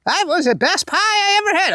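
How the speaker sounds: high-pitched mock churchgoer gushing over pie; chatty churchgoer. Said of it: "you'll hear".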